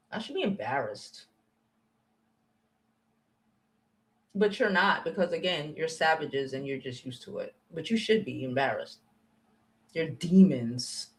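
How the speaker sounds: low, soft, disgusted; softly scorning her viewers; quiet contempt. Real, not acted. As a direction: say it low and cold.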